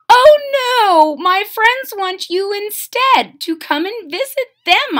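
high, loud, insistent; eager boy. Acted. The boy tells the king and queen to visit. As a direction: jump in eagerly.

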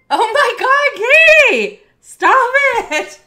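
high, loud, slow squeal; overjoyed shriek. Real, not acted. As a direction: shriek at a generous friend. Overwhelmed by a gifted sub.

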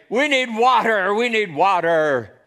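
loud, high crowd complaint; grumbling complaint. Acted. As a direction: cry out like a thirsty, complaining crowd. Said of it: "begin to grumble and complain and say".

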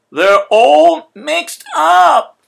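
very slow, loud, high wail; both groaning at the mess; cry together. A narrator's reading. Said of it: "they both cried".

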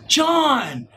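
high, emphatic call of the name John!; calling John sharply. Shown as a demonstration. Coach demonstrating tone on a name, a sharp call. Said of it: "I said the same exact word".

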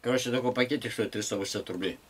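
ordinary talk, nothing marked

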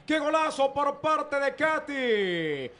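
high, excited goal recap; breakneck goal commentary. Real, not acted. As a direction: keep the goal buzz going.

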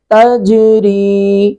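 تجری very slow, the sakin jeem in تجری bounced with an echo; qalqala on jeem. Shown as a demonstration. Teacher demonstrates qalqala on the jeem of تجری. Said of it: "جیم ساکن پہ قلقلہ کریں گے".

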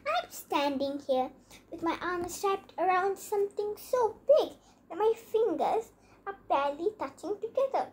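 ordinary delivery, nothing marked

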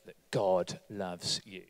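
low, slow, soft secret; hushed secret. Pretend. Preacher quietly repeats the secret to the volunteers. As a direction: lean in and hush it, slow.

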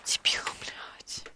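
slow unvoiced whisper; whispered insult. Real, not acted. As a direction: hiss it under your breath. Whispered insult under breath.

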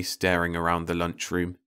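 plain reading voice

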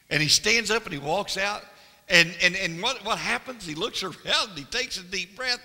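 high, loud, excited preacher's storytelling; loud preaching. Acted. Preacher retelling Jesus rising from the grave, loud and excited. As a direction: shout the story of the empty grave. Preacher acts out resurrection morning.

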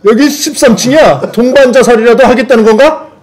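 shocked shout. Acted. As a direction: cry out in disbelief. Comic bystander shocked.

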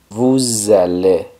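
Vous allez said slowly as "voo-zah-LAY"; liaison. Shown as a demonstration. Teacher repeats for learners, Vous allez with liaison. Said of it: "se junta con la A".

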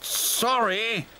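high-pitched engine character apology; apologetic diesel. A narrator's reading. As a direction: say sorry in a high put-on voice. New diesel apologises after trouble.

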